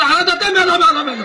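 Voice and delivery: high yelp of pain from a bumpy ride; old man yelling. A narrator's reading. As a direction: cry out that you're dying. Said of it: "एकदमच ओरडलं". (म्हाताऱ्याची ओरड)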